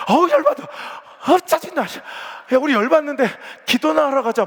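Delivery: high, loud mock fuming; fuming outburst. Deaconess fuming to a friend after the fight, high and loud. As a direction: vent your irritation, then storm off. Church women quarrel over cooking.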